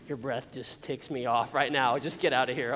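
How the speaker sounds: low, slowed, mildly fed-up quote; irritated. Acted. Acting out someone sick of a sibling's breathing, irritated.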